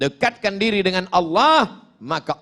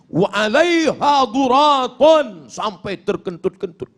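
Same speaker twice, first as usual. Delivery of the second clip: ordinary talk, nothing marked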